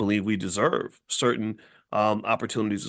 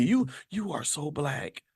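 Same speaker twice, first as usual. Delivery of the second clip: softer, slow pitying coo; mock pity; 3 semitones higher, 5 dB quieter, slower than usual. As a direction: coo it like comforting a hurt child. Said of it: "They, they say".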